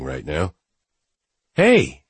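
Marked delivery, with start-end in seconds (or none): 1.6-2.0 high, loud cheerful hey; bright high greeting. Acted. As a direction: chirp it out brightly.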